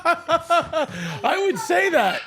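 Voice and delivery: high laugh then slow words. Real, not acted. Laughing at old bit.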